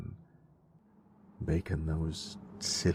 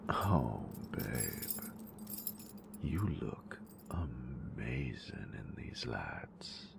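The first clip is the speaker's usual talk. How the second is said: soft, close, teasing whisper to a lover; intimate whisper; 2 semitones lower, 7 dB quieter than usual. Acted. Teasing her nerd with lights, close intimate whisper. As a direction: whisper it close, possessive and playful.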